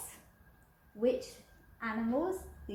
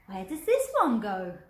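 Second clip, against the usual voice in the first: louder puzzled voice; big skeleton puzzled; 8 dB louder than usual. Acted. Big skeleton confused by leftover bones, louder. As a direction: sound baffled. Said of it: "the big skeleton said".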